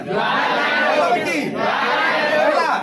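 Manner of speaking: slow, loud, stretched prompt; teacher coaxing the class to answer; drawn-out prompt. Real, not acted.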